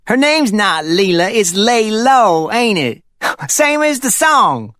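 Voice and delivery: high, slow, chuckling joke; teasing Dickie. A narrator's reading. Dickie joking the name sounds like the song, high and chuckling.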